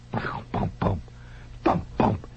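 soft imitated thuds and bumps; faint thumpings. Storyteller mimics struggle behind the dentist's door, faint thumps. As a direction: make hushed, dull thumping noises. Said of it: "faint thumpings".

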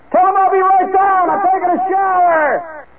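high-pitched, drawn-out yell downstairs; frantic holler. Retold or imitated. Man in the shower shouts to family, frantic holler. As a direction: holler over the running shower.

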